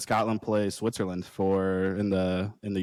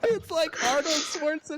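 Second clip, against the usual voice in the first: squeaky high, helpless laughing speech. Real, not acted. Teasing a friend's failed accent, laughing hard. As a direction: barely get it out through laughter, very high.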